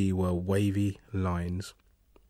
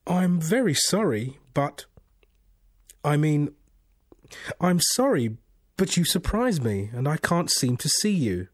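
flustered apology; 6 semitones higher than usual. A narrator's reading. Confused man apologizes to an unseen voice, flustered. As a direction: apologize in confusion, pitched well up. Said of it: "he did manage to apologize".

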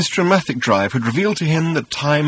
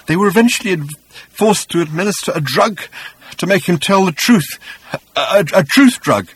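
high, frantic, broken voice; raving journalist; 6 semitones higher, 3 dB louder than usual. A narrator's reading. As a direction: babble it out like a wrecked man.

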